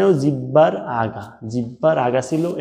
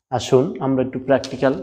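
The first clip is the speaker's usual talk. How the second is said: ordinary talk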